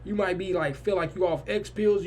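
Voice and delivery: normal talking voice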